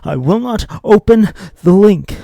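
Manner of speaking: high frantic yell, leaning on open; screamed vow. Acted. Tempted viewer losing control, high frantic scream. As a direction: break into a desperate scream.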